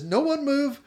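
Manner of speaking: high, loud, bustling hostess call; excited mock call. Ema to her guests, racing off for drinks, high excited call. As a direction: fling it out cheerfully over your shoulder.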